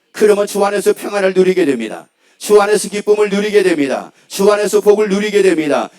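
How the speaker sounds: forceful, repeated declarations of victory; emphatic preaching. Real, not acted. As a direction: proclaim each promise to the congregation with rising conviction. Preaches a run of promises.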